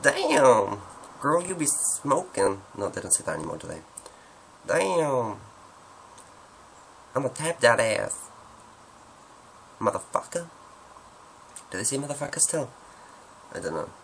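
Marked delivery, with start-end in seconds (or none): 0.0-2.6 high, drawn-out sassy American accent; exaggerated American slang catchphrase. Shown as a demonstration. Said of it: "what other one can I do". 4.7-5.4 high, falling American drawl; exaggerated American exclamation, stretched out; American accent. Shown as a demonstration. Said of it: "what other one can I do". 7.1-10.5 high-pitched American slang voice; attempted sassy American accent. Shown as a demonstration. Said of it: "what other one can I do".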